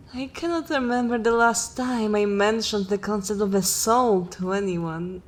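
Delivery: usual speaking voice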